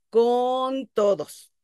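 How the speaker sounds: drawn-out, slow "con todos"; stress on "con todos". Shown as a demonstration. Teacher repeats rule for emphasis.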